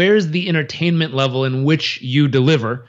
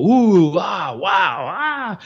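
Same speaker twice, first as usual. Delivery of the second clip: amazed; 4 semitones higher, much slower than usual. Retold or imitated. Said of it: "in a way that they go".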